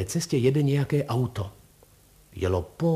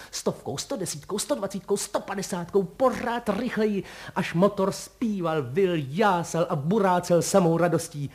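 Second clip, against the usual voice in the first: mounting excitement; 6 semitones higher than usual. A narrator's reading. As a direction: climb with the racing engine to jubilation. Narrator voices the car speeding up.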